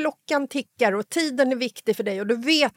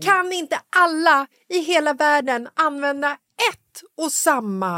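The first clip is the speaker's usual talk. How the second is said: exasperated rant; 7 semitones higher, 5 dB louder than usual. Real, not acted. Ranting about video apps.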